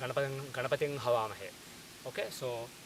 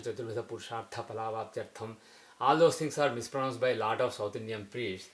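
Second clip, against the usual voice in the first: normal talking voice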